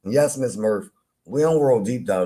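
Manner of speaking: ordinary delivery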